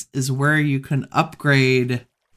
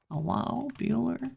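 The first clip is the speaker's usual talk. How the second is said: high, soft questioning zombie call; mock zombie call; 9 semitones higher, 9 dB quieter than usual. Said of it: "They're just like".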